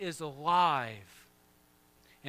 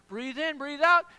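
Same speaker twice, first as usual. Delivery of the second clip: higher and louder, pumped-up breath call; energetic breathing cue; 11 semitones higher, 10 dB louder than usual. Shown as a demonstration. Pastor fires up the congregation like a workout coach, excited. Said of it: "So you gotta pause, breathe in".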